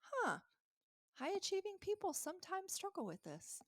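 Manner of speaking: curious, empathetic voice. Retold or imitated. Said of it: "a neutral voice ... just, like, curious".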